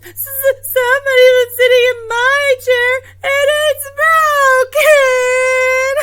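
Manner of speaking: very high, loud, wailing little bear; stammer, then howl in mock distress; Baby Bear. Finding his chair broken, hammed-up wailing little bear. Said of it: "And Baby Bear says".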